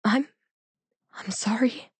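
faint, whispery stammered apology; timid, quavering near-whisper; pathetic quaver. A narrator's reading. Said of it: "a pathetic quaver warping my voice".

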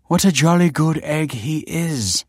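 high, slow mock posh English accent. Kelsey teases Michael in a hammed-up posh English accent. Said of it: "said Kelsey in a mock posh accent".

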